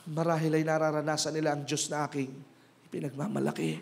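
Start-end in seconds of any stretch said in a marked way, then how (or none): none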